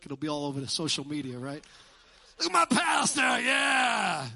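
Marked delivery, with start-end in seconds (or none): 2.4-4.3 high, loud whooping shout; mock cheering. Congregant showing off the pastor's photo, mock cheering shout. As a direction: holler like an over-proud fan.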